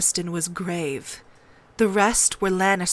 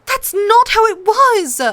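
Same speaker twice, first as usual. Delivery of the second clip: put-on much higher, louder tearful girl's protest; upset child contradicting the queen; close to tears; 14 semitones higher, 8 dB louder than usual. A narrator's reading. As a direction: fight back tears while objecting. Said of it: "close to tears again".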